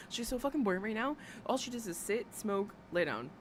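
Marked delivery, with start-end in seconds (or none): none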